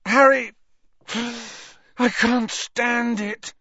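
high, loud cry slowing into anguish; desperate outburst. A narrator's reading. Ron confesses his torment to Harry, high desperate outburst. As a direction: burst out, unable to bear it.

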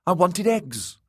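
high, petulant little lord; high-pitched childish complaint; complaining boy. A narrator's reading. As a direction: whine it like a thwarted child. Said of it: "he complained".

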